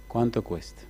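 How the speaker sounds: unhurried, unbothered by the sales pitch; patient. Acted. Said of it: "mucha paciencia le dice".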